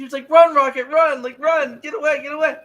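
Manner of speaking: high, loud frantic cry to run; retold screaming. Speaker imitating Floor begging Rocket to run, high frantic yell. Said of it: "Floor was screaming for him to run".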